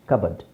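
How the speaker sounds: ಕಬರ್ಡ್ joined, with short second vowel; correct pronunciation. Shown as a demonstration. Teacher drilling how to say it, correct pronunciation. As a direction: say ಕಬರ್ಡ್ low and soft as kub-ud. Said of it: "ಏನಂತ ಹೇಳಬೇಕು".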